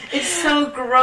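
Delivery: loud, high and disgusted; grossed out. Real, not acted. Disgust continued.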